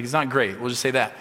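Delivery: ordinary talk, nothing marked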